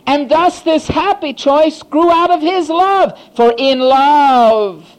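high, loud, slow preaching build; loud, high sermon climax; full-force preaching. Acted. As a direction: proclaim it to the back row.

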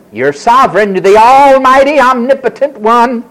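loud, rising theatrical praise; butter God up with grand titles; pointed reminding. Acted. Hannah reminding God who he is, pointed praise. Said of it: "She's reminding him".

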